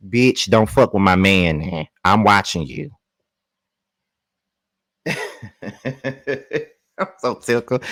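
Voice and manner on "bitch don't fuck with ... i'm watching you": louder, low, stern mock threat; possessive warning. Bando's partner warning Dublavay off him, loud and stern. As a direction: warn her off your man with attitude. Voicing a warning over her man.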